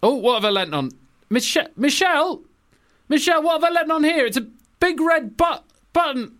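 very high, flustered comic alarm; mock panic. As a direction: call out for help in a hammed-up high voice. Acted president sits on nuclear button.

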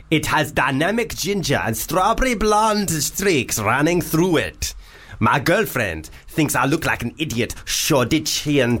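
a hammed-up panto Arabian accent reading a listener email; reading a listener's email in a silly Arabian accent. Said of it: "That was, uh, an Arabian accent".

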